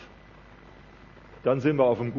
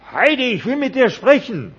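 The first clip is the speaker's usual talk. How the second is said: much higher, loud demanding yell; pushy shout; 13 semitones higher, 12 dB louder than usual. Acted. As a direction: shout it at her insistently. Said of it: "der kommt und sagt". (lauter, fordernder Schrei)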